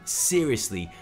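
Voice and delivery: ordinary talk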